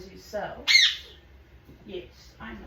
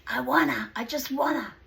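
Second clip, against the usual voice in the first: higher, whiny pleading bird voice; voicing the bird; 4 semitones higher, 4 dB louder than usual. Owner voicing her bird begging to keep his friend, whiny. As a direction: beg like a spoiled pet wanting more. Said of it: "He's like".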